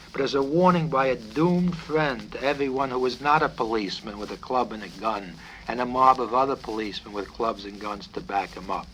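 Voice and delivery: plain reading voice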